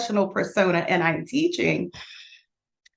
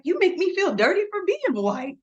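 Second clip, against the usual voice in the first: mock student complaint; 12 semitones higher than usual. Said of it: "He was like".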